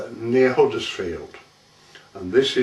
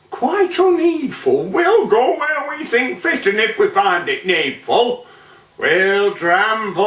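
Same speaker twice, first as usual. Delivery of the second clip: much higher-pitched, dismissive officer; haughty sergeant; 9 semitones higher than usual. A narrator's reading. Sergeant dismisses the farmer's warning, haughty. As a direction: brush the warning aside in a high voice.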